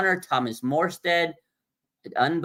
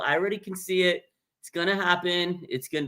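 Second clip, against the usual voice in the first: usual speaking voice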